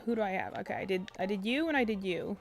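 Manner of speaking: usual speaking voice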